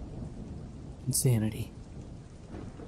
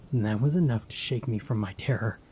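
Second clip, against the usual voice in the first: ordinary delivery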